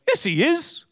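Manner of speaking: high, lightly voiced son; curt put-on son brushing him off; lightly voiced son dismissing the old man. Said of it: "The guy goes".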